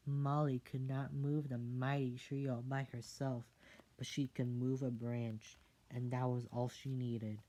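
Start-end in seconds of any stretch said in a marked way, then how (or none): none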